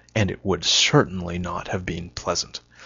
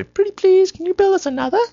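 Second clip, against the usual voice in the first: very high, pleading, far-off silly voice; squeaky begging voice; 5 dB louder than usual. Pretend. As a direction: beg sweetly in a squeaky put-on voice. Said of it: "damn, that was the wrong line".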